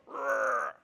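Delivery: a short rolled growl; imitated growl. Retelling a song detail, a mock growl. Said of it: "Did you hear the guy go".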